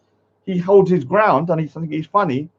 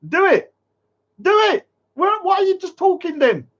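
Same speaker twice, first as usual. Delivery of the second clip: furious dare; 10 semitones higher than usual. Real, not acted. Streamer daring a threatening chat commenter to act, shouting. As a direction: yell the dare, getting higher each time.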